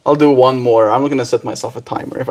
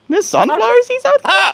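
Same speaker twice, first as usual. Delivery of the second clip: squeaky, much higher cartoon bird voice; voicing the bird. Owner voicing her bird, even higher squeaky cartoon voice. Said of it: "You're like".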